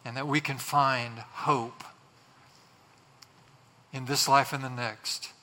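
low, measured praying voice; prayer. Real, not acted. Pastor prays to the Lord for listeners, reverent. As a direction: address God reverently, phrase by phrase with pauses.

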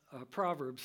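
usual speaking voice

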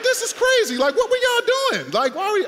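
high, loud, disbelieving outburst; incredulous. Retold or imitated. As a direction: react like this makes no sense at all. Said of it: "it's like".

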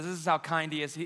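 normal talking voice

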